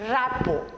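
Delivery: "rapport" said as ra-POR, no T at the end; silent T in rapport. Shown as a demonstration. Teacher showing the class how to say "rapport" with a silent T. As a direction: say "rapport" ending on an open "por", no T. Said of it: "R T is silent here".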